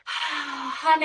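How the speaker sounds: sighing high lovey coo; sweet nothings. Acted. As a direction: sigh it out fondly, pitched high. Affectionate goodbye.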